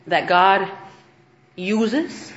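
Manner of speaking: usual speaking voice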